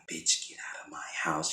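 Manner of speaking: menacing acted whisper; voiceless, deadly hushed mock threat; whispered order. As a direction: hiss the order under your breath. Acting Catherine rising from the dead.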